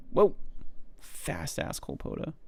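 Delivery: ordinary talk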